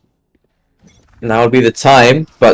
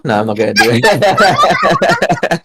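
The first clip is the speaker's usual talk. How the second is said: laughing; 5 semitones higher, slower than usual. Real, not acted. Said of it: "some things I cannot do convincingly".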